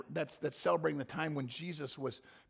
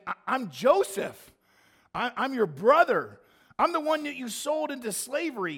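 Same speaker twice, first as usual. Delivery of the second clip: raised, louder, earnest plea to his brothers; pleading Joseph; 6 semitones higher, 6 dB louder than usual. Acted. Joseph pleads with brothers who sold him, raised and loud. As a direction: call out to them as the lost brother, urging. Preacher voices Joseph revealing himself.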